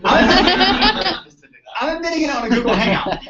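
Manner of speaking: high, loud, laughing confession. Real, not acted. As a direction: crack up while owning up.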